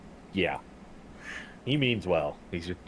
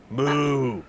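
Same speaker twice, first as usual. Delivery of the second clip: a higher, louder playful moo; mock moo; 7 semitones higher, 6 dB louder than usual. Player mooing along to the halfling, playful and loud. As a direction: moo like a cow for fun.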